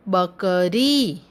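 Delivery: बकरी slowly, syllable by syllable, ending on a long री; drawn-out teaching demo of बकरी. Shown as a demonstration. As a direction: stretch the final ee of बकरी. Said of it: "शब्द का उच्चारण थोड़ा लंबा होता है".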